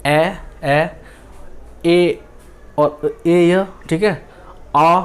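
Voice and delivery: ए said slowly as a drawn-out open vowel; vowel sound demo. Shown as a demonstration. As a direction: sound out ए and आ one at a time, slowly. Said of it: "इसको हम पढ़ते हैं आ".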